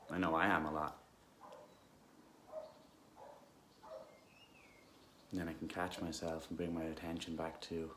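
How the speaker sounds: calm, unhurried guidance with long pauses; guided meditation. Real, not acted. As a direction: gently guide listeners into stillness.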